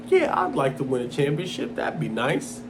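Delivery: soft, indifferent mock quote; lukewarm. Contrasting with a crying champion, lukewarm. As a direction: say it as if it hardly matters. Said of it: "lukewarm emotion".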